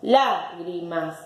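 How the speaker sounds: slow "Lágrimas" in beats, lá stressed; split into syllables. Shown as a demonstration. Teacher separating the word, syllable by syllable. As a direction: say LÁ, gri, mas for "Lágrimas". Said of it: "La separo en sílabas".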